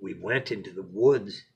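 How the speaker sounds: lively storybook narration; expressive read-aloud to a little one; bedtime story reading. A narrator's reading.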